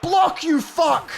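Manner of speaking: slow, high angry shout; frustrated yell. Real, not acted. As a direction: bark it at a losing fighter. Frustrated yelling at fighter.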